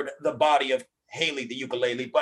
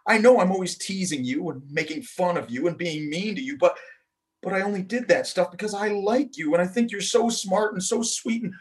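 crying; 5 semitones higher, 5 dB louder than usual. Acted. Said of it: "she cried".

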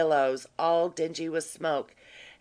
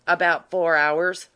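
ordinary delivery, nothing marked

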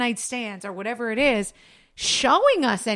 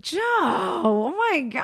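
exasperated; 6 semitones higher, slower than usual. Real, not acted. Exasperated at husband's slowness.

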